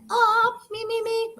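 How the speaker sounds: high voice-warming me me me; vocal warm-up. Shown as a demonstration. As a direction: warm up the voice high, sing-song. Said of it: "All right, I'm good".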